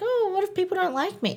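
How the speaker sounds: high, soft mock worry; imitating worried self. Her past self fretting about being liked, high and worried. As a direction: squeak out her old insecure self. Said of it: "'cause I'm like".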